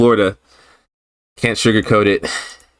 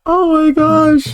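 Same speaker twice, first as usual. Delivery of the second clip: laughing; much slower than usual. Real, not acted. Laughing at own embarrassment.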